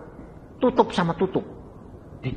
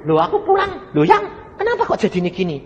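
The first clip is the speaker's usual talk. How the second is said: high mock surprise. Acting surprise at the wife's change.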